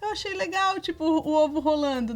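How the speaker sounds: high, mock-exasperated neighbor; high, hammed-up exasperated TV mom; Dona Florinda. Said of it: "chega a dona Florinda lá".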